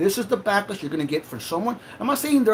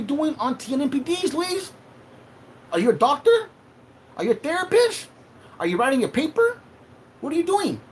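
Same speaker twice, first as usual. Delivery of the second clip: angry interrogation; 10 semitones higher than usual. Real, not acted. Confronting Louise on stream.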